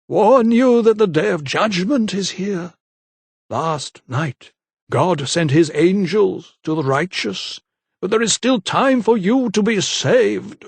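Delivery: slow, high, solemn sermon tone; preaching vicar. A narrator's reading. Vicar preaching judgment to the lady, slow and solemn. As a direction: proclaim doom like a zealot.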